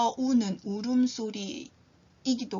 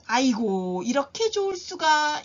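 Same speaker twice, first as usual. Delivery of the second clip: delighted; 3 semitones higher, 5 dB louder than usual. Shown as a demonstration. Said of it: "너무 좋아요".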